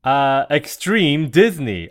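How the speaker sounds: drawn-out loud guess; loud unsure guess. Real, not acted. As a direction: call out the guess slowly.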